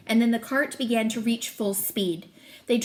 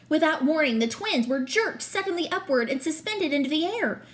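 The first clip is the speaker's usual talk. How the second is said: ordinary delivery, nothing marked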